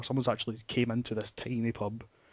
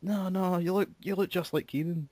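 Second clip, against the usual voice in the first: lightly put-on Aberdeenshire accent, higher; local accent impression; 8 semitones higher than usual. Local woman insisting he is Keenan, in a light Aberdeenshire accent. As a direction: insist in a broad Doric lilt. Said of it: "Great impression of the accent there".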